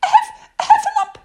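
very high, loud squeaky burst; squealing little pig out of breath; breathless Piglet. A narrator's reading. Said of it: "breathing so hard that he could hardly speak".

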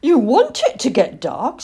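high, loud astonished question; amazed. A narrator's reading. Owlet hears the boy wants it dark, amazed. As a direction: ask it in utter disbelief. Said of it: "said Plop in amazement".

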